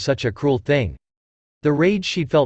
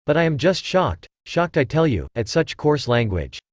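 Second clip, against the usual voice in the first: usual speaking voice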